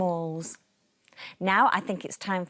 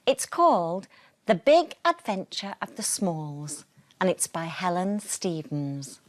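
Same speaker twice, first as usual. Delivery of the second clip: usual speaking voice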